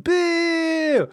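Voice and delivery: put-on high loud girl's boo. Imitating a disappointed fan booing. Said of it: "you hear this girl say".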